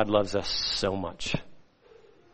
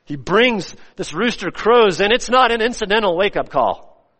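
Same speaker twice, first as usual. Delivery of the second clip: raised, higher preaching voice; full-force preaching; 10 semitones higher, 6 dB louder than usual. Real, not acted. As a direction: preach it loud and high.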